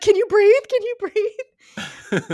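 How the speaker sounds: high mock-frantic questioning, second one drawn out; hammed-up alarm over someone choking; panicked imitation. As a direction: imitate a frantic would-be rescuer. Retold panicked questions, high pitched.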